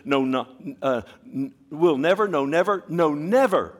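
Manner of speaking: building emphatic never. Shown as a demonstration. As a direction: hammer each never. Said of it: "it's emphatic in the Greek".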